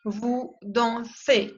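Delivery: dansez ending in a clear E sound; slow, careful vous dansez with the final E; French vous dansez. Shown as a demonstration. Teacher modelling vous dansez slowly. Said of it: "la E Z se pronuncia E".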